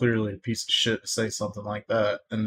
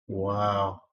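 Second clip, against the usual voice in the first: ordinary delivery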